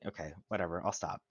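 usual speaking voice

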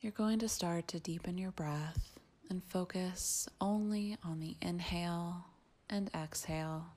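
soft, hushed meditation guide with long pauses; quiet, unhurried breathwork guidance; guided meditation. Acted. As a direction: ease them gently into the breath, very softly.

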